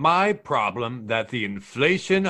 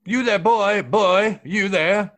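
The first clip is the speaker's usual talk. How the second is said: raised high, pompous call to a servant; pompous steward barking at a passenger; haughty scolding; 7 semitones higher, 4 dB louder, slower than usual. Acted. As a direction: lord it over the poor passenger, loud and high.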